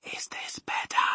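barely audible whisper; under the breath. A narrator's reading. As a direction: whisper as softly as possible. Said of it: "speaking right under her breath".

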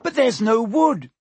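high, loud panicked cry. A narrator's reading. Hermione crying out while wringing her hands, loud panic. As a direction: wail it in helpless panic. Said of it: "Hermione cried".

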